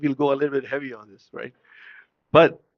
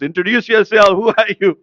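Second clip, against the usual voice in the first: loud, raised, insistent demand; voice of God; 5 semitones higher, 7 dB louder than usual. Acted. Divine voice asks Jibreel a third time, commanding. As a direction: call it out with mounting authority. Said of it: "the third time it comes".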